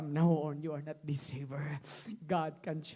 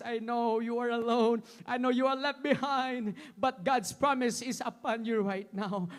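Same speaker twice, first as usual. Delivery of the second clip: shouting, crying preacher climbing to a roar; impassioned sermon building to a shout; full-force preaching; 9 semitones higher, 16 dB louder than usual. Acted. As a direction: preach it with everything, rising to a cry.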